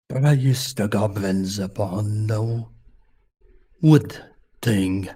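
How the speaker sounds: put-on "Praise" drawn out slow in a soft polar bear accent; quiet polar bear accent. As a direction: mumble the chant like a drowsy polar bear. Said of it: "when I'm doing the accent".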